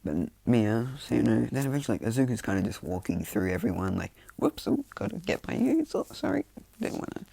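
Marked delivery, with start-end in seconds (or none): 4.4-7.3 high, light falsetto apologies; mock-innocent high-pitched voice; playful apology. Izuku slipping through the crowd, high mock-innocent apology.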